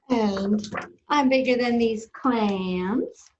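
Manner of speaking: slow, drawn-out bragging; boastful squid. Acted. Reader as squid comparing himself to sea creatures, boastful. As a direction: brag with long stretched animal names. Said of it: "he's very boastful".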